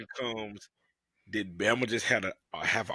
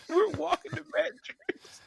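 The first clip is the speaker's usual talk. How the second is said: high-pitched, laughing retelling; cracking up at the memory, voice way up high; laughing while talking; 4 dB louder than usual. Real, not acted. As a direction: squeal it out through real laughter. Laughing through a college memory.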